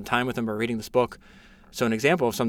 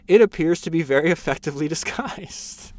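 laughing; 5 semitones higher, 4 dB louder than usual. Real, not acted. Reading Churchill's retort, laughing.

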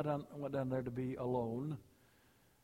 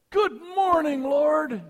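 much higher, bright and loud, leaping out of bed; cheery morning greeting; 14 semitones higher, 16 dB louder, much slower than usual. Retold or imitated. Leaper getting up, a bouncy eager hello to the Lord. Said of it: "Some folks get up and say".

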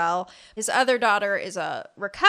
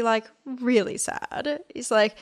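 usual speaking voice

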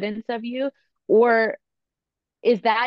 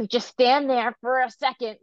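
impatient parent; 5 semitones higher than usual. Acted. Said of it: "I could totally get like that too".

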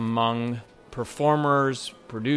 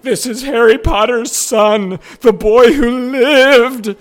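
much higher and louder, slow starstruck gasp; 13 semitones higher, 13 dB louder, slower than usual. Retold or imitated. Fan waiter retells spotting Harry Potter's actor, squealing high and loud. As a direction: freak out like a superfan spotting a star. Starstruck at seeing Jamie Parker.

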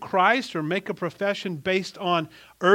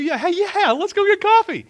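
high, loud goofy mocking voice; a husband mocking a careless friend, high and loud; sarcastic mock voice; 10 dB louder than usual. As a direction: lampoon the oblivious friend who insulted her. Mocking the insulter's casual invite.

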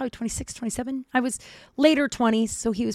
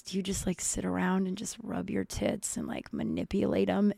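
much softer, insinuating mock-creep voice; creepy; 8 dB quieter than usual. Friend asks her a sexual question, quietly creepy. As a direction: imitate a creep asking it quietly. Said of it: "he said it so creepily".

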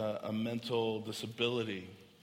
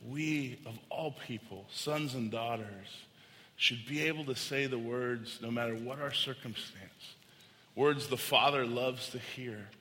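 normal talking voice

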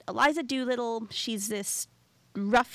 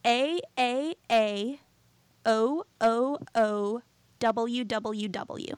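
spelled out slowly, letter by letter; deliberate, drawn-out letter-by-letter reading; 3 dB louder, much slower than usual. Shown as a demonstration. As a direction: read each letter out separately, taking your time. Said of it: "I'm going to read it out".

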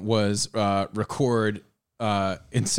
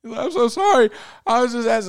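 much higher, louder put-on worker; mock apology; 13 semitones higher, 6 dB louder than usual. Worker apologising to the boss, high mock grovel. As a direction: grovel in a squeaky cartoon voice.

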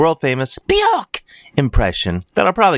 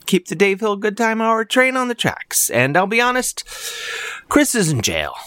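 high pitched; 11 semitones higher than usual. Acted. Pledge pitch, voice jumps high.

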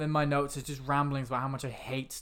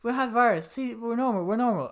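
higher, pleading [name]; Mark Wahlberg voice; 7 semitones higher than usual. Retold or imitated. Host insists it's Wahlberg, higher pleading voice. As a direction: squeak it like a bad Wahlberg. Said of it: "that's Mark Wahlberg".

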